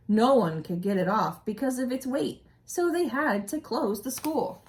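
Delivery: storybook narration for a class; expressive read-aloud story voice; reading aloud to children. A narrator's reading. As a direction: read it like a teacher sharing a picture book. Reading picture book aloud.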